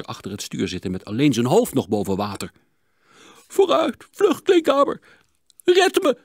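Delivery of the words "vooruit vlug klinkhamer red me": high, loud gasping plea; frantic panting cry. A narrator's reading. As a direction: beg for rescue, out of breath. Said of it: "hijgde de dokter".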